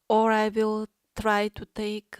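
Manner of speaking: normal talking voice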